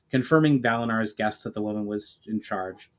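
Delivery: ordinary delivery, nothing marked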